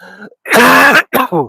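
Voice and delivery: hard cough on a swallowed fly; loud choking cough; just swallowed a fly. Real, not acted. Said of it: "As I was breathing in, the fly went in".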